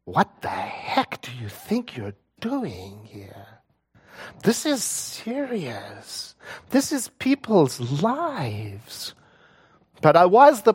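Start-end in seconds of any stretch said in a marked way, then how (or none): 0.0-9.1 a frightened voice inside her head doubting her; anxious inner voice. Retold or imitated. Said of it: "a voice in my head going".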